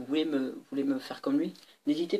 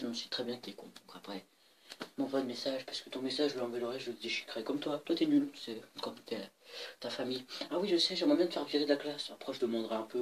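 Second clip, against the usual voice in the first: ordinary talk, nothing marked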